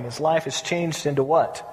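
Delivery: usual speaking voice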